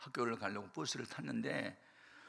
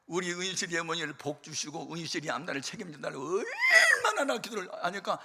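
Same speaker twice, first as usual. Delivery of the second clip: telling it through laughter, higher and louder; laughing; 6 semitones higher, 8 dB louder than usual. Real, not acted. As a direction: laugh while recounting the blessing prayer. Laughing while telling story.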